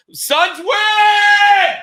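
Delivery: high, loud, drawn-out victory yell; huge celebratory holler; triumphant shout. Real, not acted. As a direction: scream it out and hold the last word.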